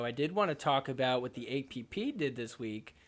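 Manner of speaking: normal talking voice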